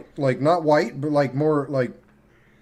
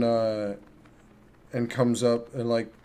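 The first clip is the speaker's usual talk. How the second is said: ordinary delivery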